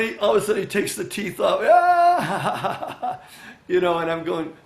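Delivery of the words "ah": loud, high laughing "ah!"; mock gotcha cry. Joker whipping off his false teeth, loud mock gotcha laugh. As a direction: burst out high and loud like a prankster.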